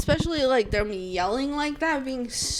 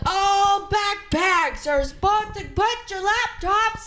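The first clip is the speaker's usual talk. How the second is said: high, drawn-out hollering announcement; mock TSA yell; 10 semitones higher, slower than usual. TSA guy herding travelers through security, mock yelling. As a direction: imitate an agent bellowing slowly down the line. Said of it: "T S A guy that's yelling".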